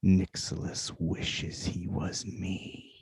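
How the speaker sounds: a menacing voice in the mind; booming dagger voice. Acted. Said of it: "in a deep, booming voice".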